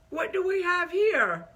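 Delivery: high, loud, dubious Irish lilt; attempted Irish accent. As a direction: imitate him with a wobbly Irish accent. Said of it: "Was that Irish? I don't know what that was".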